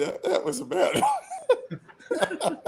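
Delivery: high and slow through laughter; giggling at his own bad joke; laughing admission. Real, not acted. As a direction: confess it flopped while cracking up.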